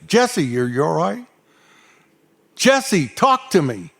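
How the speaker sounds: retold worried call, jumping high on the name; urgent calling. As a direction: call to an unresponsive kid, pressing for an answer. Calling to kid who won't answer.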